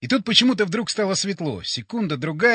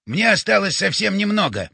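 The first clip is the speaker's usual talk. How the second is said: urgent call, moderately raised; shouted; 3 semitones higher, 3 dB louder than usual. A narrator's reading. Батя needs a little more time, urgent shout. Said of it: "крикнул он".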